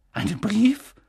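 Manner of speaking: high, whispered, incredulous echo; voice giving out. A narrator's reading. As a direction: repeat it as if strength fails you. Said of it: "mit erlahmender Stimme". (mit erlahmender Stimme, ungläubig gehaucht)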